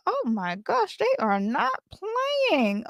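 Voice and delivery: high and slow, stretched out in awe; amazed. Retold or imitated. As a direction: gasp it like you can't believe how serious they are. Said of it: "I was like".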